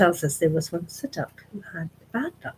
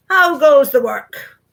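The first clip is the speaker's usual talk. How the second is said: loud slow bossy call; farmer calling out; 6 semitones higher, 11 dB louder, slower than usual. Acted. Lazy farmer calling from his bed, loud and slow. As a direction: bellow it out like a lazy boss.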